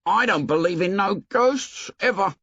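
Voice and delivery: high, indignant Piggy voice; Piggy protesting with hurt pride. A narrator's reading. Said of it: "His voice was indignant".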